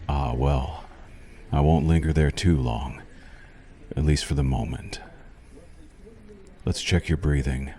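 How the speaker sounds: ordinary talk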